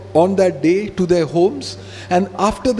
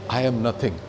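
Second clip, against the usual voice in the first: much lower and softer, surrendered; humble confession; 10 semitones lower, 6 dB quieter than usual. Pretend. A believer confessing humility before God, low and soft. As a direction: sink into it, a humbled whisper of the soul.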